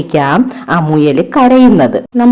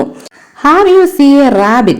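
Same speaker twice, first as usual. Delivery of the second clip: much higher and slow, a sneaky fox's question; sly fox asking; 8 semitones higher, much slower than usual. Acted. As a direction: probe them like a hunting fox. (കുറുക്കൻ തന്ത്രത്തിൽ ചോദിക്കുന്നു)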